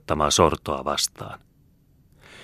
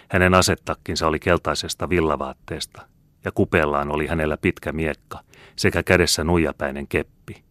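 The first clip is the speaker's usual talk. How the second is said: ordinary delivery, nothing marked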